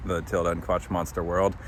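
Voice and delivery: ordinary delivery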